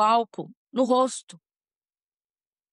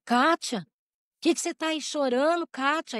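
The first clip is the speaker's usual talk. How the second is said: mother calling; 7 semitones higher than usual. Retold or imitated. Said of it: "minha mãe veio".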